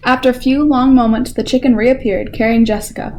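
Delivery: usual speaking voice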